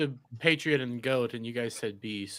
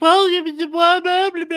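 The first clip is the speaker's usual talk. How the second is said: whiny voice; 12 semitones higher than usual. Retold or imitated. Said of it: "you get a whiny voice".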